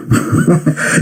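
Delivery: quick airless chuckle; a brief scornful laugh through the nose; short hushed laugh; short breathy laugh. Real, not acted. Laughs after joke.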